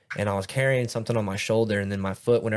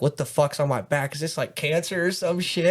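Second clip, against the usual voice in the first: higher-pitched acted alarm; worried questions, pitched up for the story; retold freak-out; 6 semitones higher than usual. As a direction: replay a mild panic over a strange discovery. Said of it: "I'm freaking out".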